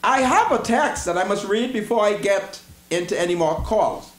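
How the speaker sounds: ordinary talk, nothing marked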